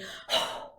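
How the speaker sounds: sharp voiceless exhale; quick breath out. Shown as a demonstration. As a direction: push the air out in one quick puff. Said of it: "So quick breath out".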